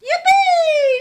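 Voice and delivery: high, loud squeal of delight; joyful shout. Acted. Ruby shouting with joy at the sleigh. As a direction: burst out with glee. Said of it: "shouted Ruby".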